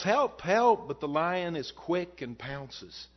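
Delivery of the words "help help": a hammed-up cry for help; mock scream for help. A narrator's reading. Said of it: "The mime screams and yells".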